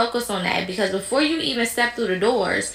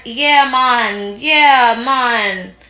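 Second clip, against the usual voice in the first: put-on louder Jamaican accent, a light parody; mock Jamaican accent; 5 dB louder, much slower than usual. Mocking Kamala's Jamaican side, loud Jamaican accent. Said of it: "show her Jamaican side".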